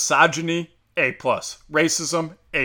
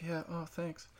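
very soft, higher wispy little voice; 4 semitones higher, 17 dB quieter, slower than usual. Acted. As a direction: put on a hushed, high, dainty voice. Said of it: "Like [name] talk, basically".

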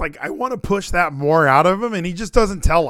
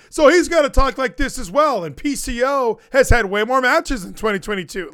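higher, pushed mock yelling; imitated yelling; 6 semitones higher than usual. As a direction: imitate a friend yelling over everyone to make a point. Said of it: "But he yells, like, while somebody else".

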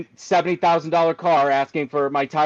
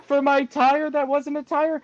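much higher, meek pleading orphan voice; Oliver Twist begging. Retold or imitated. Begging the dealership for a thirty-five dollar refund, meek orphan plea. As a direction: beg for it like a timid Dickens orphan. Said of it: "there like Oliver Twist and sing for my s".

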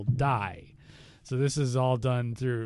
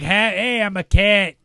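louder, higher brash "hey hey"; mock loud American; 6 semitones higher, 11 dB louder than usual. Mocking the cat's accent, loud brash American voice. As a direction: bark it like a rowdy Yank. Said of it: "They didn't talk like".

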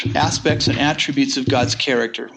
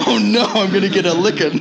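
laughing mock dread; 4 semitones higher than usual. Retelling his fear of dad's anger with a laugh. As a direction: laugh while playing his scared younger self.

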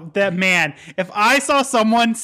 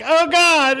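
panicked gasp; 9 semitones higher than usual. Retold or imitated. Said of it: "he's like".

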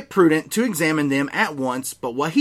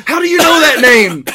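high, loud startled demand; alarmed shout; 11 semitones higher, 10 dB louder than usual. Acted. Snape alarmed at his nickname.

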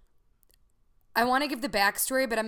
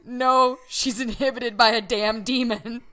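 loud, emphatic reading of a caps text; all caps text; 3 semitones higher, 7 dB louder than usual. Retold or imitated. Daughter reading her all-caps reply: loud. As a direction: shout it like it's typed in capitals. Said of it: "And I said in all caps".

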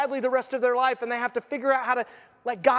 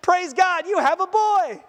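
mock announcement; 8 semitones higher, 5 dB louder, slower than usual. Voicing delivery room announcement.

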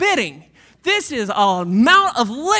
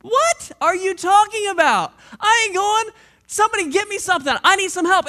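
higher, louder, rushed protest; indignant blind man; 6 semitones higher, 5 dB louder than usual. Acted. Blind man refusing to walk to the pool, loud indignant complaint. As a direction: refuse like you've been insulted.

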